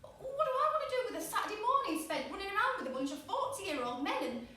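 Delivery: high, loud tirade ending in a blast; comic outrage, climbing and loud; indignant rant. Acted. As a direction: protest in disbelief, then dismiss it with a shout. Acts her rant back at him.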